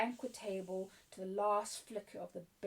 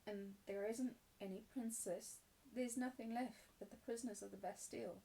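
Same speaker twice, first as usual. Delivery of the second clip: soft, sorrowful resignation; dejected; 5 dB quieter than usual. Acted. Sarah talks to her doll, dejected. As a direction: quietly admit the dream is over.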